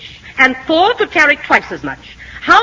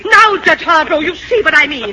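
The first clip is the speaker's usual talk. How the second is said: high-pitched, exasperated complaint; outraged teacher seeking backing; indignant appeal; 4 semitones higher than usual. Acted. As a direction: appeal to the judge, thoroughly flustered.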